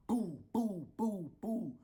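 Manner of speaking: bang out the kicks with your voice; thudding boo boo imitation; door kicks. Kicking door sound.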